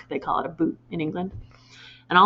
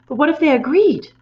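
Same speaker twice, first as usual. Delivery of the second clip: high, loud and eager; 7 semitones higher, 6 dB louder than usual. A narrator's reading. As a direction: ask eagerly. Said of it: "Matilda cried eagerly".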